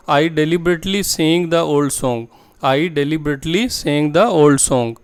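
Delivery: very slow and clearly spaced, word by word; slow model sentence. Shown as a demonstration. Teacher to Hindi-speaking students, modelling the English sentence slowly. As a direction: spell the sentence out slowly for learners. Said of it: "next sentence है".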